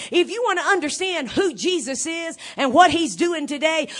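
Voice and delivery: ordinary talk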